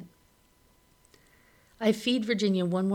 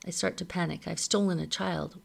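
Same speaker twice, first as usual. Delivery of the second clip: usual speaking voice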